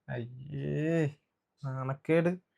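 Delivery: slow, soft, hammed-up moan of shame; mock shame wail.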